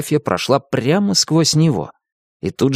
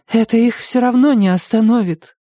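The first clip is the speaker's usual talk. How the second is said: sad queen; 12 semitones higher than usual. A narrator's reading. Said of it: "грустно покачала головой королева".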